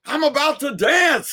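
about to leap up; excited old man. Retold or imitated. Neighbor stomping the porch, high excited cry. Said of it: "he said".

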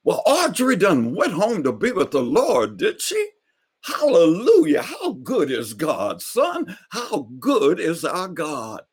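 rejoice in God like a church elder; praising old preacher. Retold or imitated. Neighbor hears of Aunt Audrey's death, high joyful praise. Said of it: "he just started praising God".

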